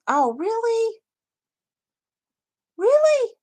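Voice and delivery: very high, astonished disbelief; shocked that the site crashed a viewer's computer; surprised. Real, not acted. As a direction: gasp the question up high.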